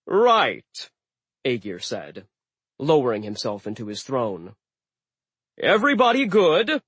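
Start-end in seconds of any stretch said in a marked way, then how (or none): 0.1-0.9 loud, high gruff word; settling into his throne; gruff giant. Acted. Aegir settles. 5.6-6.8 loud, high hearty check; host checking on guests. Acted. Aegir asks.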